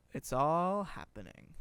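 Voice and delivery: loud, slow, drawn-out mock excitement; quoted ad exclamation. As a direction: belt it out, stretching ALL. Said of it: "I'm gonna start over".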